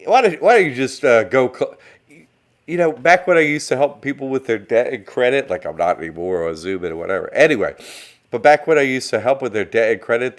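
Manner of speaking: ordinary delivery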